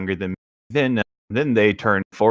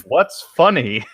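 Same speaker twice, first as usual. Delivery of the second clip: slow, higher-pitched demand; stretched-out, theatrical question; acted questioning; 4 semitones higher, 4 dB louder, slower than usual. Acting Emmett's question.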